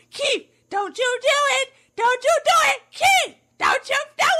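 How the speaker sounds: high, desperate imitated scream; wife yelling. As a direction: cry out in panic as the wife. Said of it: "Keith's wife yell".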